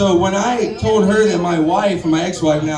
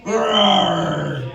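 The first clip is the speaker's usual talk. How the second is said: growling roar; 2 semitones lower than usual. Retold or imitated. Roommate growling at the dad all night, mock roar. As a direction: growl it out like a wounded animal. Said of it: "is just growling".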